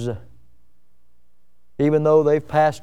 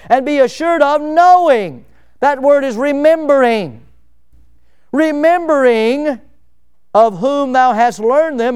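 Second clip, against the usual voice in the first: scripture preached aloud; 7 semitones higher, 5 dB louder than usual. Acted. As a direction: proclaim the verse from the pulpit. Preacher reads scripture at full force.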